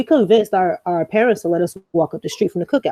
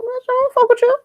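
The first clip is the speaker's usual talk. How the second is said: much higher mock voice, brushing off; squeaky put-on dismissal; a friend brushing her off, high mock voice; dismissive friend. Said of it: "they be like".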